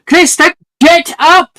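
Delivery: high, loud wake-up yell; shouting. Acted. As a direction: yell her awake from bed. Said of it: "Sarah should be like".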